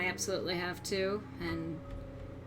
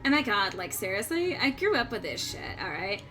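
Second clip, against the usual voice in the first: put-on bubbly sorority girl voice; valley girl, lots of like; Valley Girl accent. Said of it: "Valley Girl accent".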